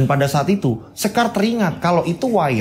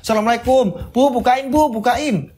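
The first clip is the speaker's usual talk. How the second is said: higher-pitched call at the door; memanggil minta dibukakan pintu; 8 semitones higher than usual. Acted. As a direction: call out to mother to be let in. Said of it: "langsung Sekar ngetok pintu". (berseru memanggil ibu dari depan pintu)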